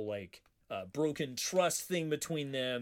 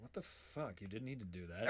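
muttered aside; 6 semitones lower, 12 dB quieter than usual. Retold or imitated. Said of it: "he's like".

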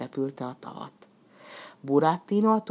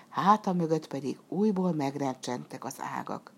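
plain reading voice

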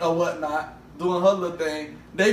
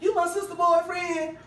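put-on high-pitched little boy question; lightly voiced little boy; retold little boy; 8 semitones higher than usual. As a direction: ask it up high like a nosy kid.

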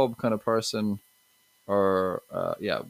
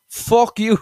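put-on much higher, louder Limerick accent insult; Limerick local; 12 semitones higher, 11 dB louder than usual. Limerick local insults the confused teen, loud thick Limerick accent. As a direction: spit it out in a broad, hammed-up Limerick accent. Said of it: "He goes".

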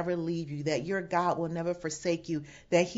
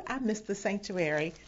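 ordinary delivery

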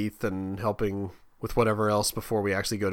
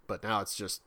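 ordinary talk

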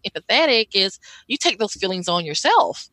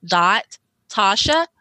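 slow, careful spelling out; over enunciating; slower than usual. Shown as a demonstration. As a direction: spell it out slowly for dictation. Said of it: "So I'll say it slowly".

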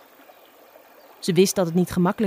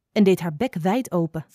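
ordinary delivery, nothing marked

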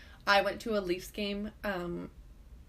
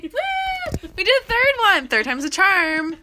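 loud high celebratory shout; excited cheer; 10 semitones higher, 12 dB louder than usual. Real, not acted. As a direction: whoop it up. Celebrating end of episode.

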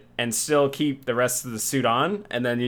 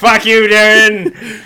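slow, higher, louder mock repeat; teasing sing-song echo; 7 semitones higher, 8 dB louder, slower than usual. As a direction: throw the insult back, stretched out. Mocking echo of friend's line.

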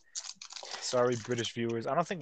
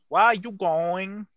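higher, louder, slow Filipino-accent imitation; mock Filipino accent; 8 semitones higher, 8 dB louder, slower than usual. Imitating a Filipino acquaintance, Filipino accent. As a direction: try out a Filipino accent hesitantly. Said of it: "let me see if I can talk like it".

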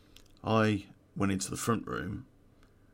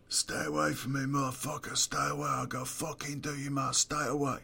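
higher-pitched frantic warning, words tumbling; panicky druggie; 6 semitones higher, 3 dB quieter than usual. Retold or imitated. Druggie with bat warning armed intruder, high panicky voice. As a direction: imitate a jumpy stoner waving a bat.

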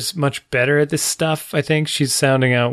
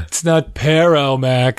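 drawn-out, superior little-sister scolding; smug sister correcting; 3 semitones higher, 4 dB louder, much slower than usual. Retold or imitated. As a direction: mockingly correct a brother's Spanish. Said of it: "Laughs at her brother".

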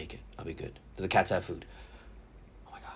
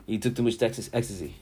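ordinary talk, nothing marked

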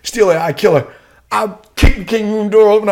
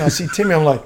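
ordinary talk

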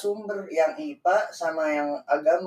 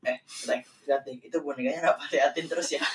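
retold sly warning; 4 semitones lower, 7 dB quieter than usual. Retells scaring a cousin with doll.

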